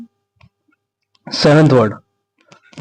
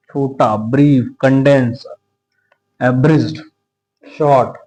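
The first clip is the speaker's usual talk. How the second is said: ordinary talk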